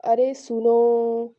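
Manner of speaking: slow, loud drawn-out call; calling out अरे सुनो, vowels held long. Shown as a demonstration. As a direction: call to someone far, stretching अरे सुनो. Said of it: "अगर किसी को बुलाना है".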